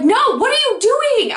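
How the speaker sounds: high-pitched, insistent disbelief; acted freak-out, voice shooting high. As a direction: retell a flustered demand, pitch jumping up. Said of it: "like, freaking out".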